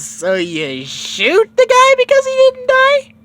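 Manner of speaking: very high, slow incredulous voice; indignant squeak. Acted. Goku to Bulma about being shot, very high and slow. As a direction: squeal the question in disbelief.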